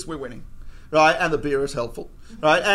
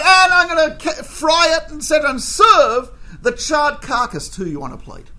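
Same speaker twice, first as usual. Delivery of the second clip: angry rant; 7 semitones higher, 6 dB louder than usual. Retold or imitated. Waiter's gory threat.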